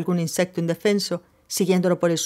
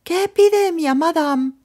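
shouted; 10 semitones higher, slower than usual. A narrator's reading. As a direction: yell it through cupped hands. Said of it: "haciendo bocina con las manos, gritó". (grito fuerte con las manos como bocina)

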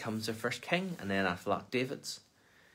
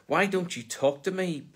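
higher, louder demand from Pilate; 8 semitones higher, 4 dB louder than usual. Acted. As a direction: press him for an answer, higher and louder. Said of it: "Pilate demanded".

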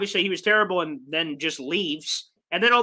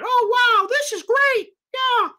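much higher, gushing mock awe, slowing at the end; sarcastic starstruck reporter impression; mocking fawning media voice; 13 semitones higher, slower than usual. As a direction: sneer at the press by gushing in a silly high voice. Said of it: "It said".

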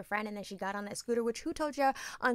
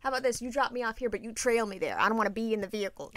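normal talking voice

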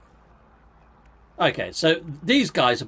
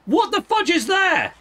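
startled; 4 dB louder, slower than usual. Real, not acted. Hit by unseen plant.

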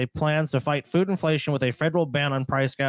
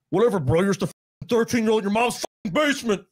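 mocking gamer; 6 semitones higher than usual. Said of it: "they'd be like".

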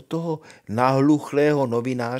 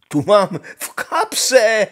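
pomalu, hlasitě a vysoko; chvástavý Honza; 9 semitones higher, 10 dB louder, slower than usual. Acted. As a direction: vychloubej se pomalu a nahlas. Honza boasts boisterously. (Honza se hlasitě chlubí princezně)

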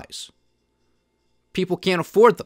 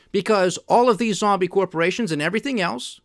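ordinary talk, nothing marked

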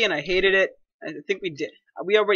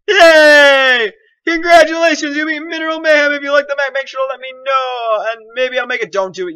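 high, put-on cheering voice; loud wailed yay, then high singsong reading; hammed-up congratulations; mock celebration; 6 semitones higher, 7 dB louder than usual. Reads end sign in mock celebration.

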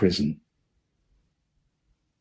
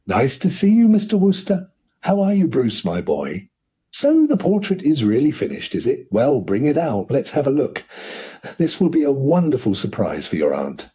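a hearty, pleased uncle, pitched higher; jovial uncle; 6 semitones higher than usual. Acted. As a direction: greet them like a proud rich uncle.

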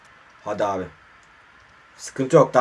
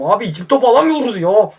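annoyed complaint; 6 semitones higher, 5 dB louder than usual. Real, not acted. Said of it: "Vallahi sinirlendim".

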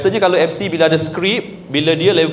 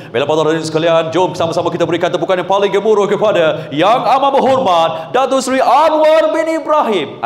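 fast hype announcer. Shown as a demonstration. MC calling for thunderous applause, quick and climbing. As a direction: race through the welcome, then soar on the name. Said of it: "ada orang lagi laju".

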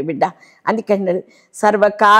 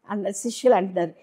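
ordinary talk, nothing marked

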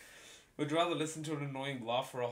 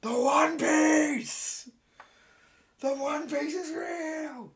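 slow, high, drawn-out yell; hyped shout; 10 dB louder, much slower than usual. Acted. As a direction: announce it like a crazed fan.

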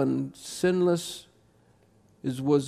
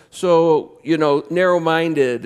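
mock accusation; 7 dB louder, slower than usual. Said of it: "and you Christians".

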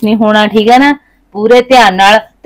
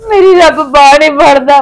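sobbing through the quote; weeping lament, voice breaking; crying; 2 semitones higher, 3 dB louder than usual. Acted. Widow recalls dead husband's words, crying. Wife mourns husband's death.

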